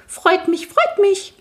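very high, eager chirping; high goblin voice. Acted. Night goblin greets the will-o'-wisp, high squeaky voice. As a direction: squeak it out delightedly.

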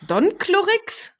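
high, slow, disbelieving question; incredulous echo. Real, not acted. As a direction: repeat it like you can't believe it. Disbelieving echo of odd name. (ungläubig nachgefragt)

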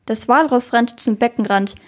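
ordinary delivery

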